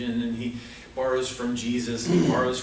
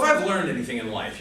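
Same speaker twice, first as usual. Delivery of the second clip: usual speaking voice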